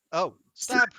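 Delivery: loud gleeful cry; excited stab. Pretend. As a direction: shout it with glee. Gleeful mock stabbing sounds.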